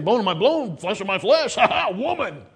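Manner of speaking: a light, laughing take on a delighted Adam; excited Adam. Retold or imitated. Preacher voicing Adam meeting Eve, lightly excited and laughing. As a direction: act out Adam's joy, ending in a laugh. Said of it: "I was excited".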